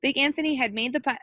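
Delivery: ordinary delivery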